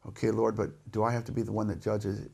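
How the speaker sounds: low, very soft plea; meek, reluctant question. Acted. Prophet asking God to be spared judging, meekly. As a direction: ask it small and hushed.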